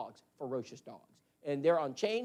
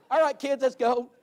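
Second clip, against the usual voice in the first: calling out; 6 semitones higher, 8 dB louder than usual. Retold or imitated. Calling kids out to the yard.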